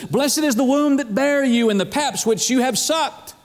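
woman crying out a blessing; lifted-up voice. Acted. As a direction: call it out high over a crowd. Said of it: "lifted up her voice and said".

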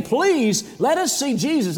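acted high-pitched, urgent pleading; begging. Greeks begging Philip to see Jesus, acted pleading. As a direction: plead as if asking over and over. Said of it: "in fact, they were begging".

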